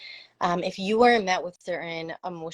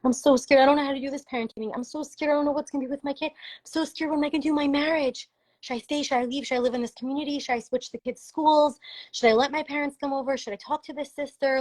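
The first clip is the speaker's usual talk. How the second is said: higher, fast, anxious rush of worried questions; scared and overwhelmed; 7 semitones higher than usual. Retold or imitated. A parent pleading to be held, frightened and rushing. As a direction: voice a frightened parent spilling every fear. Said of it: "Hold my hand as I say".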